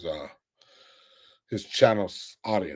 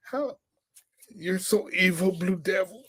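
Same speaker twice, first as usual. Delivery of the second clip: much higher, giggling mock voice of a rival streamer; mocking giggly impression; 10 semitones higher than usual. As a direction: mimic him cackling at himself like a maniac. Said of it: "He's like".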